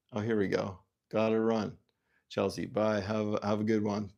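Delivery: normal talking voice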